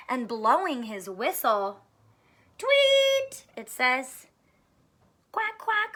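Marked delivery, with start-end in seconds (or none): 2.6-3.5 high whistle sound; high tweet. A narrator's reading. Policeman blows his whistle. 5.3-6.0 high duck quacks; quacking. A narrator's reading. Duck sound label.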